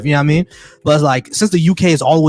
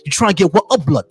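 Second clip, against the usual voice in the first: put-on high-pitched UK gangster slang in a British accent; exaggerated London street accent; mock British accent; 6 semitones higher than usual. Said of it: "when I hear that accent".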